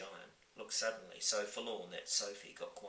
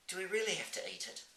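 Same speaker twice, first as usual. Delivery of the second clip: put-on young girl voice; 11 semitones higher, faster than usual. Sophie pleads.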